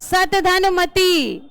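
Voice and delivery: सत धन मति said slowly, सत not सम; slow correction. Shown as a demonstration. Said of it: "सम नहीं". (धीरे से सुधारकर सत धन मति)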